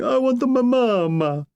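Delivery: put-on a grown count wailing like a child; mock crying. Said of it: "Count Ciano crying".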